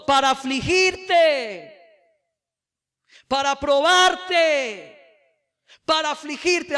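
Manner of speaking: full-force preaching. Acted. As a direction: preach it hard to the congregation. Reading the verse emphatically.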